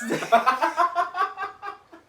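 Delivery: loud, high cackling laugh; real burst of laughter. Real, not acted. Comic cracks up at own joke, loud laughter.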